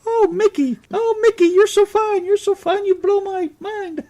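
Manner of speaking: very high cartoon falsetto; Minnie Mouse. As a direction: gush in a squeaky little mouse voice. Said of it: "You like 'em to sound like Minnie Mouse?".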